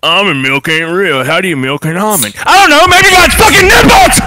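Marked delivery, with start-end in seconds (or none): 2.4-4.2 high, loud outburst; pitched way up and hollered; exasperated shout. Real, not acted. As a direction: yell back in mock outrage. Mock argument about almond milk.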